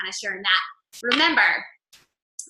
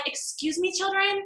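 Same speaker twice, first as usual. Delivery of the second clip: higher, mock-stern scolding of the kids; exasperated scolding; 5 semitones higher than usual. Mom scolding kids grabbing everything at grocery store, exasperated.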